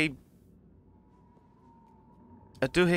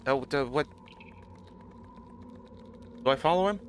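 usual speaking voice

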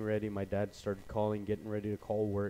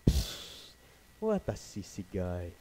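scoffing psst then dismissive line; lightly voiced scoffing father; a hint of dad scoffing; 3 semitones lower, slower than usual. Dad dismissing his son as a sissy, light scoff. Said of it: "he always says".

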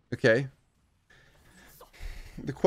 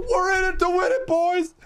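higher and louder, pumped up; excited victory cry; hyped shout; 3 dB louder than usual. Real, not acted. Celebrating to chat.

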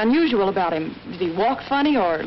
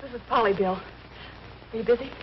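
weak and faint; 2 semitones lower, 7 dB quieter, slower than usual. Acted. Hurt woman phoning after attack.

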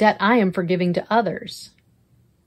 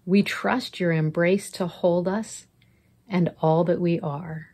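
guided prayer; 3 dB quieter than usual. Acted. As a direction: lead them gently into prayer.

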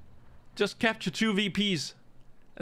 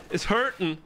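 ordinary talk, nothing marked

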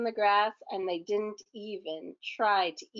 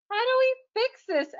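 high cartoon voice; 13 semitones higher, 4 dB louder than usual. Miss Fix-It's character voice.

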